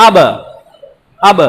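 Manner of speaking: আব with the lips bouncing apart on ব; echoed ab. Shown as a demonstration. Teacher showing the lip echo on আব. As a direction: let ব in আব pop off both lips. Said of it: "ঠোঁটে ঠোঁটে বাড়ি খাবে".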